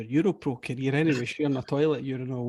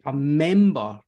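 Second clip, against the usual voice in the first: MEMBER stretched and louder, as if in quote marks; inverted commas; 6 dB louder, slower than usual. Real, not acted. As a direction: lean hard on "member" with a rise and fall. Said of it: "I use the inverted kind of brackets".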